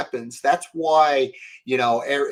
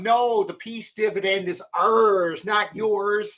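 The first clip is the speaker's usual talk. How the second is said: high, slow mocking voice of the powers that be; lightly voiced smug official; mock elite retort; 9 semitones higher, slower than usual. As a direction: sneer it back as the smug elite. Mock quote of elites to public.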